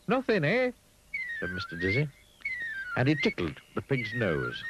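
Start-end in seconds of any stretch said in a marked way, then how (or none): 0.1-0.7 high and brightly sure. Acted. Mister Dizzy answers the pig, high and sure. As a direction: answer with easy confidence.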